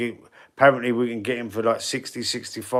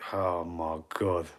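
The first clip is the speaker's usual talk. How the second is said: exasperated groan; 6 semitones lower, 11 dB quieter, slower than usual. Real, not acted. Groans at the chat insult.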